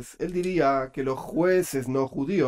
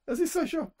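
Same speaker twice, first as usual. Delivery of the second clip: laughing; 11 semitones higher, 8 dB quieter than usual. Real, not acted. Laughing at his own self-description.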